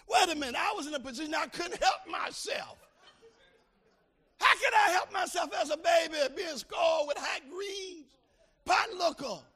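high, loud shouted preaching with a sung edge; preaching at full force. Acted. Preacher testifying to the congregation, shouting. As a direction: build to a sung climax.

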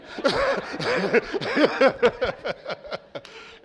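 long, loud burst of laughter; hearty laugh. Real, not acted. Pastor teasing a volunteer, hearty laugh. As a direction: laugh out loud at your own joke. Joking about reluctant volunteer.

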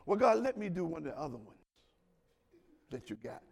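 soft, quick aside to God, tailing low; sheepish mock plea, kept very quiet; hushed bargaining. Believer asking God for another commandment, hushed bargaining.

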